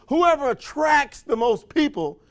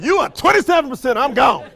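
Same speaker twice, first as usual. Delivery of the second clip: loud, high brush-off; hammed-up loud brush-off; mock declaration; 5 semitones higher, 7 dB louder than usual. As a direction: declare it and walk out. Spouse leaving, quoted.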